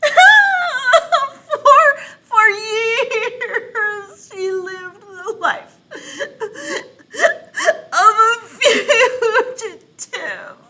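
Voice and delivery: high, loud, slow hammed-up wailing sobs; fake crying. Teacher pretends to cry while reading her book. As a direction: overact the sobbing while reading. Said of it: "I'm going to pretend to cry".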